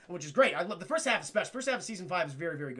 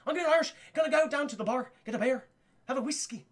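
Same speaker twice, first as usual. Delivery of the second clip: higher-pitched attempt at an Irish lilt; Irish accent; 5 semitones higher, faster than usual. Shown as a demonstration. Streamer showing off his Irish accent, lilting Irish. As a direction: put on a light-hearted Irish accent. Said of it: "I can do an Irish accent".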